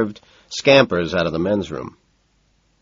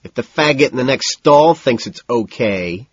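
loud, pointed and higher; loud taunt aimed at the stranger next door; raised voice; 6 semitones higher, 5 dB louder than usual. A narrator's reading. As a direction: call it out so the next stall hears. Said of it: "And then he raises his voice".

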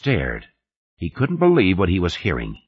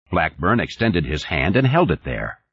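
ordinary delivery, nothing marked

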